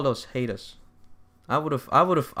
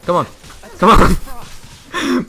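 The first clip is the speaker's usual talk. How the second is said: cheering someone on; 12 semitones higher, 9 dB louder, much slower than usual. Real, not acted. Urging teammates on.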